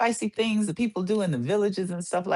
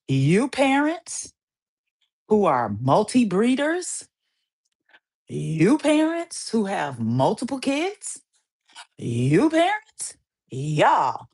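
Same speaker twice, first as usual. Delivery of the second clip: slow call-out; 3 semitones higher, slower than usual. Real, not acted. Calling out parents slowly.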